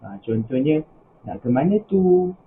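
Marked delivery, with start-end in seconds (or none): 1.3-2.3 "Nak ke mana tu?" lifted high toward the end; rising question intonation. Shown as a demonstration. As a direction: raise the pitch on "mana tu" to show it's a question. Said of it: "yang menaik di hujung dia". (nada tanya "Nak ke mana tu?" menaik di hujung)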